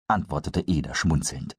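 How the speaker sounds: usual speaking voice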